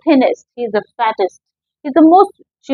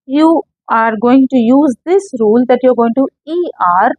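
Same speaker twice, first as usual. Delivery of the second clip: ordinary delivery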